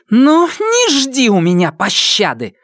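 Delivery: high, slow furious threat; seething shrieking spirit. A narrator's reading. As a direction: blurt out a threat in rage. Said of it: "выпалила она".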